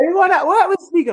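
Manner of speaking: high, fast, fussy mum voice; mum's voice. Retold or imitated. Mum asking her son about his club, high quick voice. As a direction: squeak it like a worried mum.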